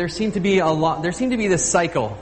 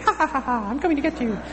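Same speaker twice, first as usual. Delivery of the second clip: high, squeaky chipmunk-style giant laughing and threatening; comic sped-up villain, pitched way up; sped-up Goliath; 11 semitones higher, faster than usual. Retold or imitated. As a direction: imitate a giant's taunt on a sped-up tape. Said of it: "We used to speed it up".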